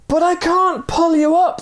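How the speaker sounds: high, loud cry; anguished cry. Acted. Man crying down to the stranger, high anguished cry. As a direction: strain it out in despair. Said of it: "the man cried".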